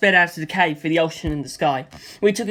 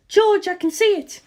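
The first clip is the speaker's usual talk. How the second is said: excited call; 14 semitones higher than usual. Acted. Lisa Lisa calling to George that she sees London. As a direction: call out to George, spotting the target. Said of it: "Lisa Lisa cried".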